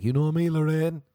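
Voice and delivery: high, loud and cheeky; playful self-quote. Acted. Comedian playing himself greeting Lorraine, playful and loud. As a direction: sing out a jokey familiar greeting. Acts out imagined chat with Lorraine.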